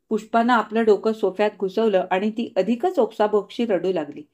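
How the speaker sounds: usual speaking voice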